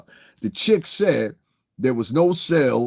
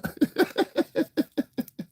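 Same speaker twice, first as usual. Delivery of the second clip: high, wheezy run of laughter; high staccato giggling fit; laughing; 5 dB quieter than usual. Real, not acted. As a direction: crack up at your own silly accent. Laughs at own mock accent.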